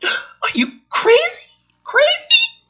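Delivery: gasp, then a high, incredulous "crazy?"; shocked gasp. Real, not acted. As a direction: squeak it out in disbelief. Shocked reaction to the shotgun scene.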